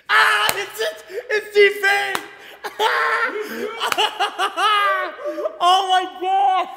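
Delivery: high, loud shrieks breaking into laughter; ecstatic screaming. Real, not acted. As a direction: scream like your idol just walked in. [name] confirmed in his chat.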